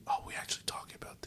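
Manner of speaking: fast whispered aside; whispered disbelief, quick and hushed. Real, not acted. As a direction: mutter it fast under your breath. Aside as friend continues topic.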